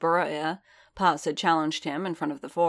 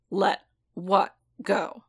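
slow, raised, word-by-word challenge; growl; 4 semitones higher, slower than usual. A narrator's reading. Boba demands Din explain what is let go, growling. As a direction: bite out each word separately, pitched up. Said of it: "Boba growled".

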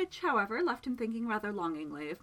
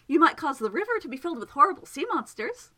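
eager suggestion; 6 semitones higher, 8 dB louder than usual. A narrator's reading.

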